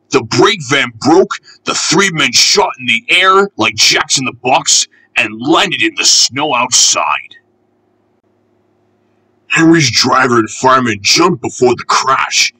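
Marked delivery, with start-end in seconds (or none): none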